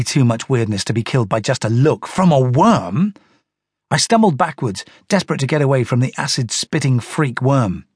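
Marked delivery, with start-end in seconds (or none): none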